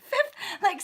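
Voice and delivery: ordinary delivery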